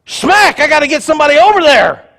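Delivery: high, surprised, loud mock panic; a flustered deity caught off guard, hammed up; panicked God. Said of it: "he's not sitting up there going".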